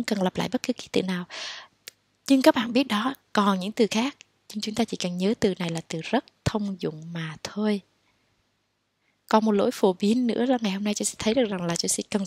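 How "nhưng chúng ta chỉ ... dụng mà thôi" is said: soft whispered aside. Real, not acted. Teacher whispering a tip to the class. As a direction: hush it like a tip.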